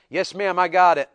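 loud, brisk obedient reply; snap to attention. Shown as a demonstration. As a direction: demonstrate a soldier-like answer to mom. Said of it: "snap to attention".

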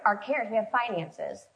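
usual speaking voice

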